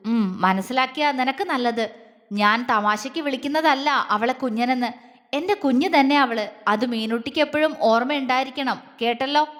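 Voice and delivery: usual speaking voice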